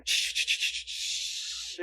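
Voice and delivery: imitated hissing sizzle of grilling fish; sizzling noise. Preacher mimics Jesus grilling fish for Peter, sizzling. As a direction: make a drawn-out sizzle.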